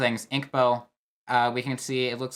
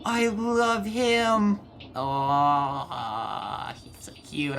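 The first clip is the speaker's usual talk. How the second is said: cooing; 3 semitones higher, much slower than usual. Real, not acted. Gamer cooing at the little buddy on the train, cutesy baby talk. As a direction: gush like you're talking to a puppy. Cooing at cute character again.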